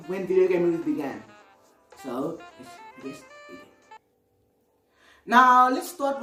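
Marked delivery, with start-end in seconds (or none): none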